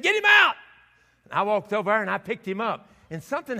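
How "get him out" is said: high-pitched mock wife command; insistent order. Wife ordering husband to remove the rat, insistent. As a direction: act out a wife insisting in a much higher pitch.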